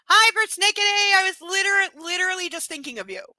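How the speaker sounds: high, loud burst of joy; excited greeting. Real, not acted. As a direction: greet a friend, thrilled.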